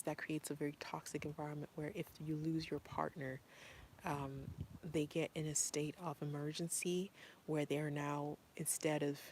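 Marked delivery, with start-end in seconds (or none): none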